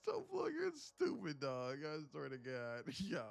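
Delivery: squeaky high, talking through laughter; cracking up, voice shooting up high; real helpless laughter. Real, not acted. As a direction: crack up and try to speak. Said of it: "That's why I was laughing".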